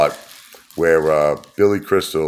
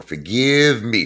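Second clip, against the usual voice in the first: mock pleading; 6 semitones higher, slower than usual.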